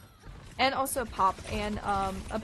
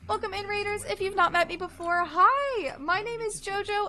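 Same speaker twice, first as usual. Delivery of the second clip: high, bubbly streamer greeting; cute anime streamer; 8 semitones higher, 6 dB louder than usual. Acted. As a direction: squeal a welcome to the raiders. Raid welcome in streamer voice.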